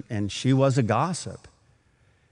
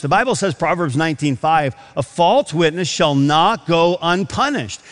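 a preacher pressing the point from the pulpit, louder; fervent preaching; 4 semitones higher, 4 dB louder than usual. Acted. Preacher warning the church about lying, in a fervent raised voice. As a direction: build it like a sermon climax.

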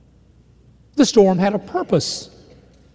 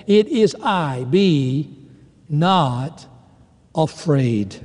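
sweet calming voice; 3 semitones lower, much slower than usual. Retold or imitated. Jesus calming his frightened disciples in the storm, slow soothing voice. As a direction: soothe frightened men with each word. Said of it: "you can hear his sweet, calming voice".

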